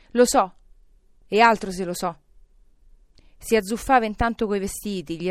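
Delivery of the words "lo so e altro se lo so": loud, elated certainty; excited and insistent; emphatic exclamation. A narrator's reading. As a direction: burst out with conviction. Scrooge exclaims in overjoyed relief.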